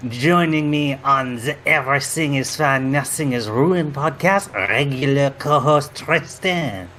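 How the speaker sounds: hammed-up outrageous French accent; comic podcast host in a mock French accent; fake French accent. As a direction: ham up a jokey French accent. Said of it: "we all have this outrageous accent".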